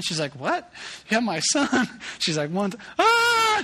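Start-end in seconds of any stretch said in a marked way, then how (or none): 0.3-1.9 high, rising disbelief; a mom hearing her lost son is found; shocked what-did-you-say; stunned. Retold or imitated. Wife stunned by mall cop call. 2.5-3.6 very high, slow, loud flustered count; counting kids and losing track mid-number; a hammed-up frantic mom; panicked counting.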